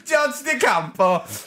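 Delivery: mock Italian. Mocking celebrity chef name.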